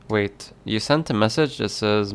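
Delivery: ordinary talk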